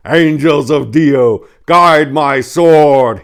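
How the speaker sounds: loud, slow holy proclamation; proclaiming chaplain. Acted. Brother Dorn proclaims before charging the devil, slow and loud. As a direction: proclaim it like a battle prayer. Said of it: "he proclaimed".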